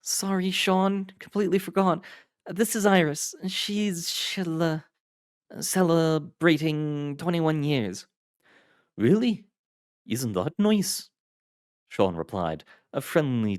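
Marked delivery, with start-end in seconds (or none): 0.0-8.1 high apologetic woman's voice; sheepish, slowing at the end; Adams voiced. A narrator's reading. 9.0-11.1 high friendly Irish voice; jovial host, surprised; Sean voiced. A narrator's reading.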